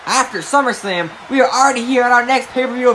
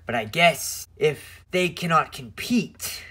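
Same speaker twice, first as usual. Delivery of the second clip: villain promo voice; 7 semitones lower, 8 dB quieter than usual. Acted. Messiah character low villain promo.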